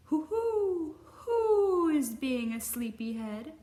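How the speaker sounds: high hooting owl, slow and loud. Acted. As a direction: hoot it like a wise old owl. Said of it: "asked Owl".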